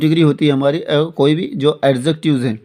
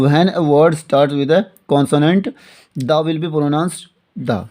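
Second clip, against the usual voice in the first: slow, word by word, for students to write; dictation pace; much slower than usual. Shown as a demonstration. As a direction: spell the rule out slowly for the class. Teacher dictating a rule to write. (धीरे-धीरे लिखवाते हुए)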